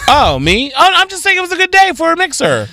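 high, loud mock innocence; innocent deflecting. Real, not acted. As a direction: play dumb, sing-song and high. Caught dodging the question.

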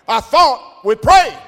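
high, loud and slow, the word prayed rising; emphatic shout. Retold or imitated. Preacher voicing the healed man shouting back at him. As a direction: hammer it home as a shout.

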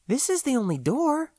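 cautious partner holding him back; Floy's voice. Acted. Floy voiced by the narrator.

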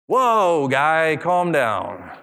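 usual speaking voice

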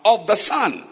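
slow, measured Bible reading; scripture reading. Acted. Preacher reading a Bible passage to the congregation, slow and solemn. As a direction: read it phrase by phrase with long pauses.

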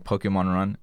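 ordinary delivery

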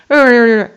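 very loud growling in imitation of her shouting; mock angry growling. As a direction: growl her anger back at him. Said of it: "like, shouting".

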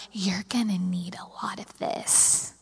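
whispered, slow, like a secret; hushed dramatic whisper of a father. Retold or imitated. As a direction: breathe it out as dad's big moment. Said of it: "he can be a little dramatic too".

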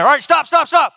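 high, loud call to stop. Acted. Friend on the roof yelling at the others to stop. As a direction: halt them with a sharp shout.

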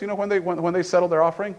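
ordinary delivery

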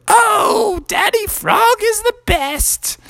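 Froggy, a small, high frog voice. Acted. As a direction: squeak it out like a tiny frog. Froggy praising his dad.

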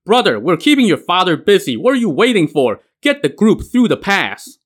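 high, loud call over the battle; shouting across the fight. Acted. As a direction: yell it to be heard across the pass. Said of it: "Huang Ming shouted to him".

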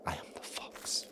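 breathy hushed whisper, half to himself; dramatic whisper. Acted. Podcaster whispers his Zorro line to listeners, a dramatic whisper. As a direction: whisper it like a masked vigilante's secret.

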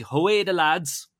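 high, loud, drawn-out Geordie call; Geordie accent. Acted. Podcast host shouting out Newcastle fans, bad Geordie accent. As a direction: attempt a Geordie cheer, stretching the vowels. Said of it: "That was a bad Geordie accent".

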